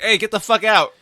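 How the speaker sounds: indignant shout, half in earnest; mock holler. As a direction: holler it like you're calling out a line-cutter. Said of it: "do is holler".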